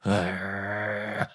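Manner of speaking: drawn-out low growl; imitated wolf growl. Preacher as wolf watching the door, mock growl. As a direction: growl like a wolf lying in wait.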